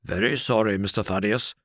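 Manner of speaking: rough servant's firm refusal; unbending doorman, not an inch given; inexorable porter. A narrator's reading. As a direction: porter turning Thaddeus away, firm and rough. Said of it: "said the porter inexorably".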